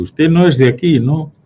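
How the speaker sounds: más agudo y más fuerte, encarando al forastero; confrontación burlona. Retold or imitated. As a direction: imitando al vasco que increpa, en voz alta. Basque local confronting the Catalan. (el vasco del bar increpa al catalán, agudo y fuerte)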